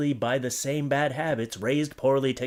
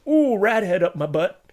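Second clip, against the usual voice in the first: higher and louder, squeamish ooh; mock buyer; 6 semitones higher, 6 dB louder than usual. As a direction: act out squirming into the rat underwear. Imitating a buyer putting it on.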